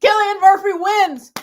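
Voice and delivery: high, loud victory shout; excited cheer. Real, not acted. Co-host celebrating Cillian Murphy's win, excited shout. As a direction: announce the win at full cheer.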